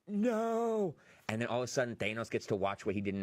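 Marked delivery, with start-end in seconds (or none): 0.1-1.0 high, mock-despairing "no"; dismayed cry. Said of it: "And you're just like".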